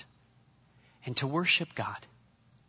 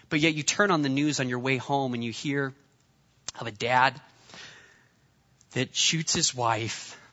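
ordinary talk

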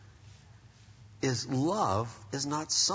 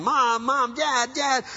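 put-on whiny kids calling; 7 semitones higher, 4 dB louder, slower than usual. Bickering kids calling parents.

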